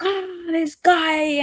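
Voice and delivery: high, drawn-out exasperated groan of a line; exasperated driver. Retold or imitated. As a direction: act out griping at another driver. Reacting to being cut off driving.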